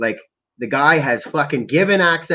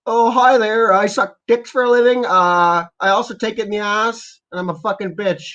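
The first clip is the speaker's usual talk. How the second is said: higher-pitched, crude parody voice; mock Doctor Fauci; 6 semitones higher than usual. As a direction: send him up in a high put-on voice. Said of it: "What does Doctor Fauci sound like?".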